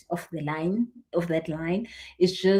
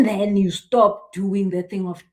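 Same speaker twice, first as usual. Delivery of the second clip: normal talking voice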